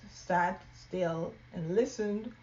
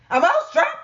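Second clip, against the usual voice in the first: loud, high squeaky question; very loudly; 12 semitones higher, 11 dB louder than usual. Acted. Loud Mouse asking mama about traps very loudly. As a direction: blurt it out at full volume. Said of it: "very loudly".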